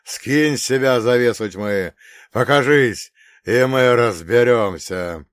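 loud, hoarse priestly challenge; hoarse booming voice. Acted. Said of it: "гулко произнёс священник охрипшим голосом". (гулкий охрипший голос)

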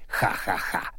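airy forced chuckle; unvoiced nervous laugh; nervous breathy laugh. A narrator's reading. Nervous mad-scientist laugh.

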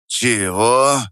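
протяжно, в недоумении; протяни «чего» с недоверием; непонимающе протянул. Acted. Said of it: "непонимающе протянул Мельник". (мельник непонимающе тянет)

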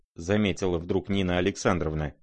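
plain reading voice

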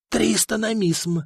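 slow, squeezed reluctant offer; grudging strained offer; forced out. A narrator's reading. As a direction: push the number out unwillingly. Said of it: "выдавил из себя ростовщик".

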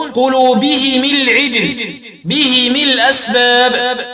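"قلوبهم العجل بهم الأسباب" linked with -himi; slow recitation, i after h and m in "قلوبهم" and "بهم"; kasr ha and mim. Shown as a demonstration. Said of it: "بكسر الهاء والميم وصلا".

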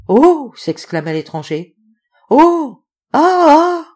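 high, loud terrified cries; frightened shrieks. A narrator's reading. Stranger startled by the ape, crying out in fear.

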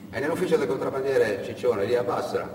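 ordinary delivery

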